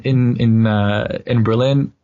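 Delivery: normal talking voice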